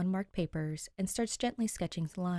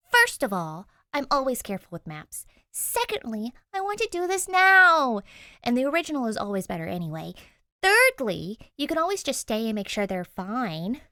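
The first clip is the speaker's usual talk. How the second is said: louder, higher, rushing protest; insistent argument; 5 semitones higher, 7 dB louder than usual. Acted. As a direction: push back hard, counting points. Danny argues to get maps.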